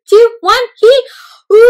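high, loud countdown into a shouted roared. Shown as a demonstration. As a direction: shout it with the class. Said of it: "you have to say it so loud".

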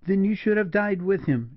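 high, wild reproach; heedless, impassioned woman's outburst; cried out. A narrator's reading. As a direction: cry it at him, pitched high. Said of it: "she cried".

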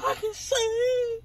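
mock healed cry.

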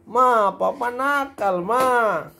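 mock the kid whining to mum, drawn out; imitating whiny child. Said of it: "Kalau dulu waktu kecil gitu".

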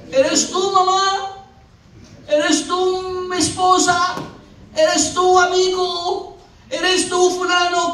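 high, drawn-out calling; hammed-up searching call; calling out questioningly. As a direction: call across the water, unsure who it is. Mock calling to wrong people.

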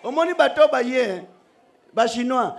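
usual speaking voice

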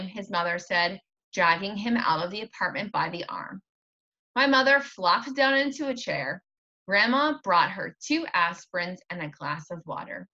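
usual speaking voice

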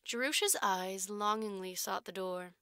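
ordinary delivery, nothing marked

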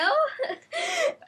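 high burst of laughter; laughing. Real, not acted. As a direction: crack up laughing. Laughing at radio story.

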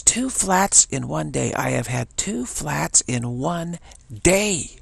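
exasperated guy. Retold or imitated. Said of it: "And he says".

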